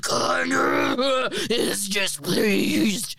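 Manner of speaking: slow, booming possessed scream. Acted. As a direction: shout it like a vengeful spirit. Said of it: "Okay, so you scream".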